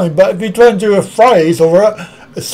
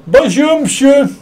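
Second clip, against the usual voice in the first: high, sing-song bonjour monsieur; 7 semitones higher than usual. Real, not acted. Showing off French to the chat, a high cheery bonjour. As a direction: greet him like a cheery Frenchman. Shows off his French greeting.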